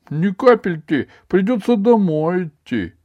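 put-on high, loud boy's voice; whiny work-shy pupil; cheeky schoolboy. A narrator's reading. Ivan dodges studying, in boy's voice.

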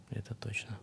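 «Это точно» breathed with no voice; whispered. Real, not acted.

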